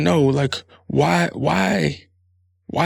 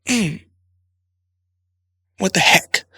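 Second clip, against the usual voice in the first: mock near-curser; 5 semitones higher, 3 dB louder, slower than usual. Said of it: "tiptoe right on it".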